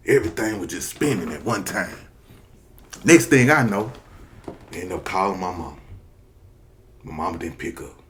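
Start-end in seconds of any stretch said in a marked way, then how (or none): none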